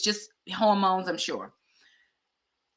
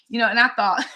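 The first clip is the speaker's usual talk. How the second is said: usual speaking voice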